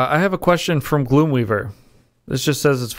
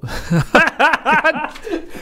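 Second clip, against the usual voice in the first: high burst of laughter; cracking up after the flub; laughing fit. Real, not acted. Breaks up mid-reading.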